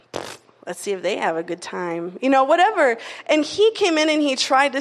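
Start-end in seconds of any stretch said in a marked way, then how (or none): 0.1-2.2 low, soft scoffing devil voice; devil's taunt. Retold or imitated. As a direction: sneer it quietly like the devil. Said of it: "And the devil's like".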